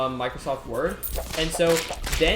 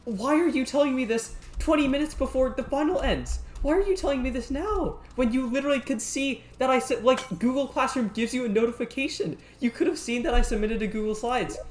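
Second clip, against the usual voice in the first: exasperated rant; 8 semitones higher than usual. Retold or imitated. Retold exasperated rant at teacher.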